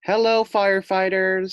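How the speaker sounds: put-on high, loud chorus of children calling hello; a class of kids greeting visitors together; kids' greeting. Kids greet the firefighters in a high loud chorus. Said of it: "said all the kids".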